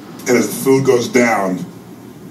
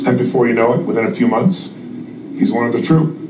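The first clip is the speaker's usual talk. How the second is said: ordinary talk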